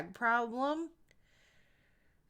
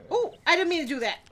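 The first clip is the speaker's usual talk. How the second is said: startled; 9 semitones higher, 10 dB louder, much faster than usual. Real, not acted. Horse accident in the game.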